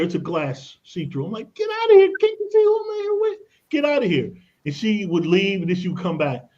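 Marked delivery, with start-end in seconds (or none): 1.5-4.3 much higher, louder, yelling at the door; exasperated shout. Retold or imitated. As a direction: shoo her off in a strained cry. Shooing receptionist from meeting.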